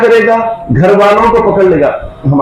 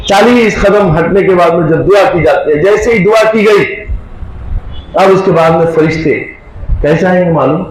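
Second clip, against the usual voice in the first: usual speaking voice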